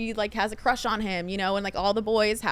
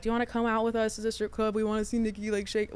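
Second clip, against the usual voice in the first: bro voice; 3 dB quieter than usual. Retold or imitated. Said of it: "I like how you did the bro voice".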